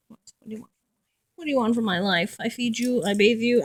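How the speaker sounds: ordinary talk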